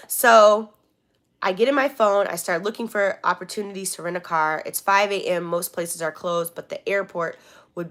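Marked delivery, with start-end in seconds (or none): none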